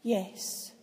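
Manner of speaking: a soft, hushed single word; gentle quiet assent. Acted. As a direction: barely breathe the yes. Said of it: "quietly said one word".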